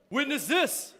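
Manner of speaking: high booming trailer voice; playful hammed-up title announcement; announcer voice. Shown as a demonstration. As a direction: belt the title like a hype announcer. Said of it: "You gotta say it like that".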